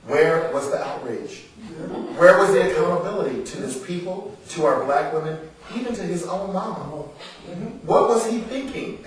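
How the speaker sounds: rising outrage, half laughing; indignant outrage. Acted. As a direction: demand it of the audience, building to disbelief. Outraged rhetorical questions while reading.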